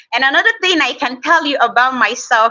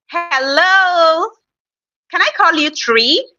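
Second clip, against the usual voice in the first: careful greeting; 5 semitones higher than usual. Real, not acted. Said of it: "I'm really being careful so that we're able to pronounce it".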